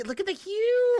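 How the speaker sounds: squeaked high look at the hue; excited pointing out. Real, not acted. Fan showing viewers the panel's colours, squeaky and delighted.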